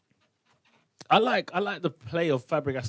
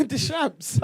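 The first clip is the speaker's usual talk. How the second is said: laughing; 12 semitones higher, 5 dB louder than usual. Real, not acted. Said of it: "I was like".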